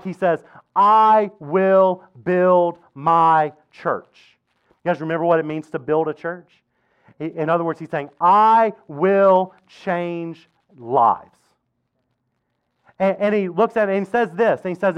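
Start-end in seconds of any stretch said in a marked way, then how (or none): 0.7-4.4 very slow, each word separated. Acted. Jesus promises Peter his church, very slow and deliberate. Preacher weighting Jesus's key words. 8.2-11.4 drawn-out, spaced-out declaration; word by word. Acted. Jesus promises to change lives, very slow and deliberate.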